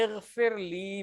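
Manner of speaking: اغفر لی drawn out very slowly, syllable by syllable; teacher reciting اغفر لی slowly so students catch the لی; slow Arabic recitation. Shown as a demonstration. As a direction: sound out اغفر لی carefully for the class. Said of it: "عربی میں ہم پڑھتے ہیں".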